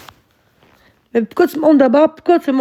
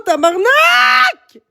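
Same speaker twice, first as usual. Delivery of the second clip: high, loud, slow stretched swear; furious shout; 11 semitones higher, 6 dB louder, much slower than usual. Real, not acted. Yelling at viewers who won't leave her alone, a furious drawn-out shout. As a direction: bellow it long and high.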